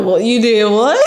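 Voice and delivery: playfully scandalized, voice shooting up at the end; retold incredulous squawk; mock shock. Retold shocked reply to husband.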